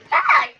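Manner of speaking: sharp high yelp; short high scream of surprise; high shriek. Real, not acted. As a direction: let out a startled shriek. Sudden shock in game.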